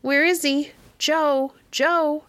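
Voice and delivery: high, carrying call for the dog. A narrator's reading. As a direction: call his name across the house. Calling for the dog.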